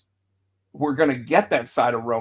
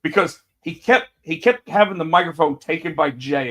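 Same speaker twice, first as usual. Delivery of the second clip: usual speaking voice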